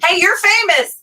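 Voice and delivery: high, loud imitated holler; calling out. Small-town stranger spotting someone famous, calling out.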